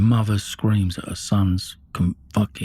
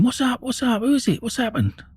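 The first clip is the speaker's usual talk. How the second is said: imitating screaming mum; 14 semitones higher than usual. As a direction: replay mum's panicked screech in quick bursts. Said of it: "she's come screaming out the room".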